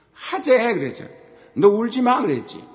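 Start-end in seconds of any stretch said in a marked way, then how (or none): 0.1-0.7 son's challenge. Retold or imitated. Retold challenge from son. 1.5-2.2 loud warning. Retold or imitated. Father warns son before wrestling.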